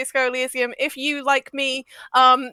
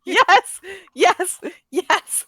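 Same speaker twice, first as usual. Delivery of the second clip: higher, louder delighted yes; excited cheering; 7 semitones higher, 3 dB louder, much slower than usual. Real, not acted. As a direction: cheer it on like a win. Cheering a friend's suggestion.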